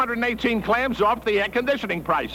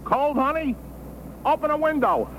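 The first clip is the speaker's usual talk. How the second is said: smug teasing; 9 semitones higher than usual. Acted. Husband teasing cold wife.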